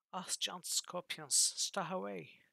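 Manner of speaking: voiced stranger crying out from a house. A narrator's reading. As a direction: call out a warning, drawn out. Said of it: "cried the voice".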